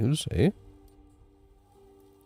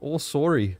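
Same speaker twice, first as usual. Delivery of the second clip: Canadian sorry; 7 semitones higher than usual. Shown as a demonstration. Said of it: "That's another one".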